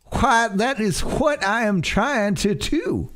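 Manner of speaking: high, slow Southern lawyer drawl; a put-upon uncle in a Southern drawl. Acted. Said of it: "Can we just go to McDonald's, Uncle".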